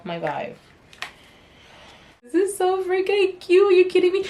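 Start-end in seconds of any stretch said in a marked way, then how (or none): 2.2-4.3 high-pitched gushing over something cute; real excited squeal-talk, pitched way up; gushing delight. Real, not acted. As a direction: gush in delighted disbelief.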